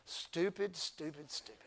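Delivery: low, soft, self-mocking stupid; muttered stupid. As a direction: mutter stupid at yourself under your breath. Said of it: "You know what you're gonna say?".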